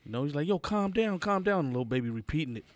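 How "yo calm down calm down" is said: higher, mock firm dad; imitating the dad telling his toddler to calm down; a parent hushing a restless kid in the back seat; dad calming son. Dad voiced calming fidgety son.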